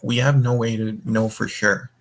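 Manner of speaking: ordinary delivery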